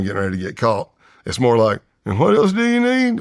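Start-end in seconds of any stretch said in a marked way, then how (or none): 2.1-3.2 "And what else do you need?" said much higher, throat tight; higher pitched voice. Shown as a demonstration. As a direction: squeeze it up high with a tight throat. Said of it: "with a higher pitched voice".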